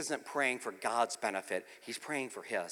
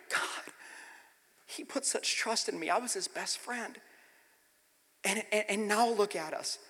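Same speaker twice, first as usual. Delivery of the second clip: higher, pained lament; feeling worthless; 8 semitones higher than usual. Retold or imitated. Peter grieving that he failed his best friend, feeling worthless. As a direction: plead it with regret, voice lifted. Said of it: "picture Peter feeling so worthless".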